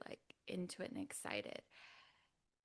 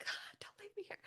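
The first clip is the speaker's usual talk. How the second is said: desperate whispered plea; 5 dB quieter than usual. Retold or imitated. Pleading to husband.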